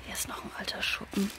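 hushed whisper; nervous night vlog whisper. Real, not acted. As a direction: whisper under your breath.

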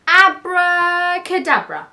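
loud, high, stretched-out spell; magician's incantation. A narrator's reading. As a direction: cast it slowly and grandly. Keith casts a magic spell.

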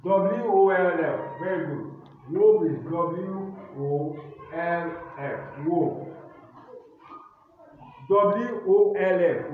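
loud, slow classroom dictation voice; spelling dictation. Acted. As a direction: call each word and letter out to the class. Teacher dictating spelling words.

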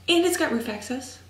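ordinary talk, nothing marked

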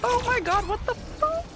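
very high squealing disbelief; shocked shriek. Real, not acted. Game ambush.

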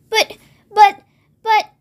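halting, repeated false starts; stammering. A narrator's reading. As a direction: stammer it out with gaps between words. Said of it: "stammered George's mother".

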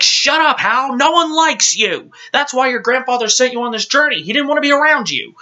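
very high, quickening comic rant; mock exasperated yell. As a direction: scold him in a screechy sitcom yell. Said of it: "that's how I feel about Hal".

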